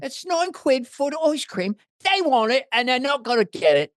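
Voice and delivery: mock Cockney complaint about prices; Cockney accent. Man imitating Michael Caine's Cockney over ice cream prices. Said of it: "Now I'm doing Michael Caine".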